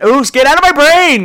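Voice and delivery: high, loud mock squeal; squealing delight. Squealing at a dirty thought.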